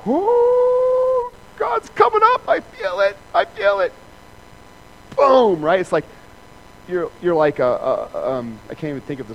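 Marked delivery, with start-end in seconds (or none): 0.1-3.9 much higher, louder, hammed-up ecstasy; theatrical mock rapture; mock power-up. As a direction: ham up a holy power surge. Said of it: "here I am".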